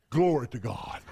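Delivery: low, sudden mock-pious calm; pretend piety. Family arriving at church after fighting, put-on pious greeting. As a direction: switch instantly into churchy serenity. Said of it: "show 'em some pretending".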